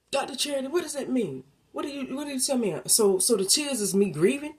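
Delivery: high, eager questioning voice; curious listener. Acted. A listener questioning Doctor Charity, high and eager. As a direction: ask her like a puzzled listener.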